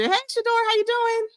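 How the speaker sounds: bright hello sung way up high; cheery high greeting. Real, not acted. Doctor calling her practice manager onto the show, high sing-song hello. Greeting co-host as she comes on.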